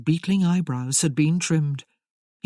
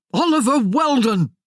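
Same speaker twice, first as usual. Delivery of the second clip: loud, high outraged shout of his own name; 8 semitones higher, 7 dB louder than usual. Acted. Merlin shouting his name at the woman who forgot him, loud and high. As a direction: bellow it at her in wounded outrage. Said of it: "shouted Merlin".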